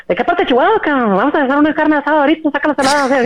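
put-on very high, racing Chihuahua Mexican accent. Morphing fully for someone from Chihuahua, exaggerated high Chihuahua accent. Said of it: "he's from Chihuahua".

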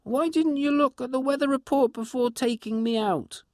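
dog scolding her owner about the weather; dog's imagined voice. Acted. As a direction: voice the dog's silent complaint. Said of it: "looked at me as if to say".

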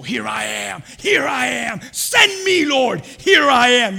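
high, full-voiced shout, building; shouted answer. Believer answering God's call, impassioned shout. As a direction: cry it out to heaven with all your force.

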